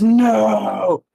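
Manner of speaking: high, loud cry of defeat. Acted. The dragon being sealed in the staff, a high loud cry. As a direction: cry out as you're dragged down.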